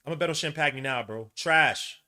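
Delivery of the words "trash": spat insult. Real, not acted. Fan insulting player.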